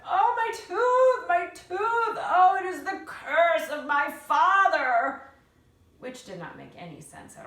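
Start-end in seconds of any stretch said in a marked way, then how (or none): 0.1-5.2 wailing granny with a sore tooth; moaning in pain. A narrator's reading. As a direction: groan the words out through toothache. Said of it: "Granny started to whimper".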